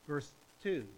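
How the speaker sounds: ordinary delivery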